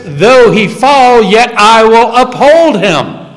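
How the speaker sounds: slow, ringing declaration of God's word; emphatic. Acted. Preacher proclaims scripture quote.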